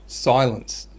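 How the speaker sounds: ordinary talk, nothing marked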